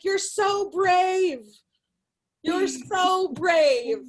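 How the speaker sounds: slow, drawn-out mock praise; mock mom. Mom fakes praise at a swimsuit, slow and drawn out. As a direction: stretch out brave like a fake compliment. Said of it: "my mom's like".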